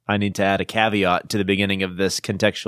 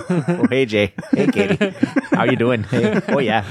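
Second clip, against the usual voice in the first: laughing mock Fargo accent; playful upper-Midwest Fargo, amused. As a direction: greet neighbours in hammed-up Fargo. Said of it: "how do they talk in Fargo?".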